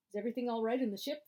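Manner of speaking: brisk captain question. Acted. Captain questioning crew member.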